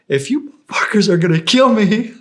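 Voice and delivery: high, loud furious rant; angry yell. Retold or imitated. Sick guard yells at his own team, high, loud and furious. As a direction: tear into them at full volume.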